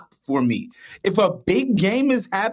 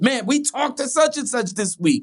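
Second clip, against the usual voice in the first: high, rushing, giddy retelling; Collinsworth excited; 7 semitones higher than usual. Retold or imitated. Imitating an amped-up broadcaster sharing inside scoop, excited. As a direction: gush like a thrilled football dork. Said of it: "he's really excited. He's like".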